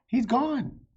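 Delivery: high, loud burst of amazement; high excited shout; excited exclamation. Acted. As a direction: cry it out, thrilled. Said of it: "Annie exclaims at the knight".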